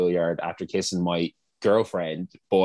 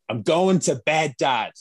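mock teenage defiance, high and drawn out; imitating an aggressive, drunk teen; retold aggressive outburst; 7 semitones higher, 3 dB louder, slower than usual. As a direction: snap back at dad, slow and pitched up. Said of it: "I was so aggressive".

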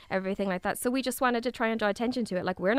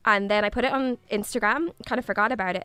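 ordinary delivery

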